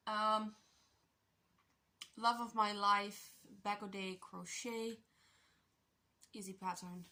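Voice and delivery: talking while chewing gum. Real, not acted. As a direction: gum in the mouth, a bit slow. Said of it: "I have gum in my mouth".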